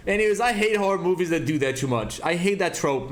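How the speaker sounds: ordinary delivery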